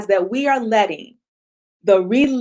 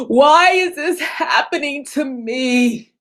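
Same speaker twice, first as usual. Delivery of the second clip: slow self-pitying wail, higher; poor-me victim whine; whiny victim; 3 semitones higher, slower than usual. Acted. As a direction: moan it like the world is against you. Acting out a victim.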